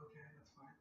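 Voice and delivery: hushed, breathy shrug of a reply; mock fiancée reply. Fiancé mimics fiancée's soft mock reply. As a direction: play the fiancée, barely voiced and resigned. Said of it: "she's like".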